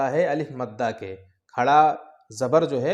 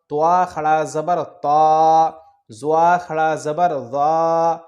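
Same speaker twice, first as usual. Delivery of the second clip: slow loud spelling drill; 5 dB louder, much slower than usual. Shown as a demonstration. Teacher chanting the alphabet drill to pupils, slow and loud. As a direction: chant each letter slowly, loud and drawn.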